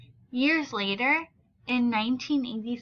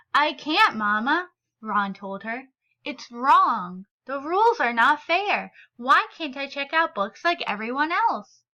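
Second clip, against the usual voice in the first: story reading. A narrator's reading. Teacher reads picture book aloud.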